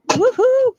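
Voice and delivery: high, loud whoop; cheering her team on with a loud high whoop. Real, not acted. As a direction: whoop with real excitement. Cheering for the game.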